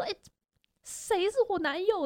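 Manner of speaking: high-pitched flustered denial; mock indignant girl. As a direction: act the girl brushing it off, flustered. Girl denies having a boyfriend.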